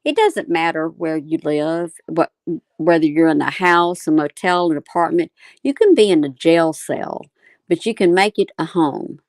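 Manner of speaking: usual speaking voice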